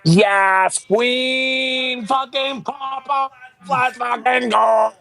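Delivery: high, drawn-out hype cheer; excited cheering. Real, not acted. Cheering on the performance.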